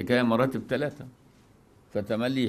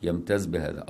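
normal talking voice

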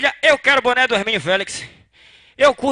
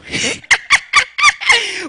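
squealing high-pitched laugh; shrill cackle of laughter; 11 semitones higher than usual. Real, not acted. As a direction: crack up at your own punchline. Laughing at own joke.

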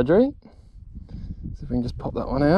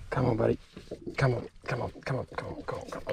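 gentle coaxing; 13 dB quieter, much faster than usual. Real, not acted. Angler getting a fish back quickly, softly coaxing. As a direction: coax a buddy along under your breath.

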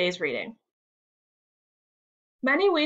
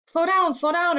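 raised, cautioning storybook voice; urgent advice; 8 semitones higher, 4 dB louder than usual. A narrator's reading. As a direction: call out a quick word of caution. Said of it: "advised Samuel".

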